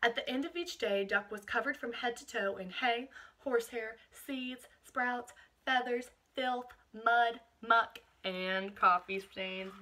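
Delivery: put-on reading a picture book aloud to children; expressive read-aloud, savouring each list item; picture-book narration for a class; storybook reading.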